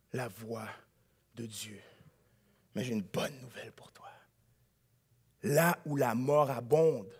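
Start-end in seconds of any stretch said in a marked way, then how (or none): 0.1-1.8 hushed fade. Real, not acted. Chute dramatique dans le sermon. 2.7-4.1 whispered good news, close and secret. Real, not acted. Confidence whispered to the listener.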